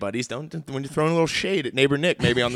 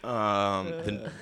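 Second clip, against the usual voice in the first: ordinary talk, nothing marked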